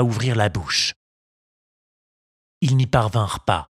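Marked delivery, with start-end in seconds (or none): none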